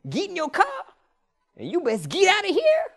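imitating a Klan leader telling him to leave; threatening local. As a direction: order him out of town, high-pitched. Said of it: "He said".

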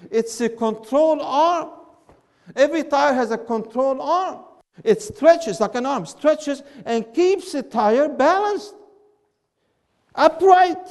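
loud, high, slow declaration; emphatic proclamation. Real, not acted. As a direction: drive the answer home word by word. Proclaims the answer to class.

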